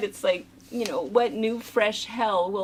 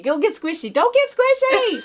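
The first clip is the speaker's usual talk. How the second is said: higher, rising mock scolding; 12 semitones higher, 5 dB louder than usual. Mother ordering the kids about the cat, hammed-up scolding. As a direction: bark it at the kids. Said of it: "So then his name was just Squishy".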